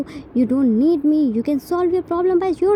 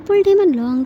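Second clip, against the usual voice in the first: ordinary delivery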